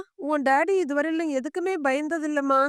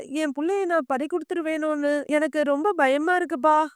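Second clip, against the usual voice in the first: ordinary delivery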